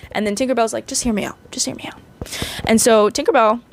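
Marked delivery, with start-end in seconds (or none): 0.9-2.0 urgent whisper. Retold or imitated. Tinkerbell pleads with the queen.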